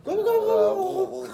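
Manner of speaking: high squeaky laugh. Pretend. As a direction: laugh up high, still in the turkey voice.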